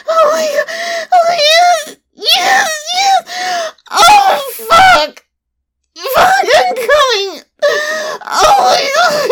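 high, loud, breathless cries; ecstatic shouting. Acted. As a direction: let it break out at the peak. Climax, shouting out.

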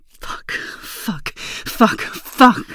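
quick repeated fuck, whispered then building to a loud cry; hard and fast. Shown as a demonstration. Showing the listener hard and fast, building to loud. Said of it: "what if I say it hard and fast?".

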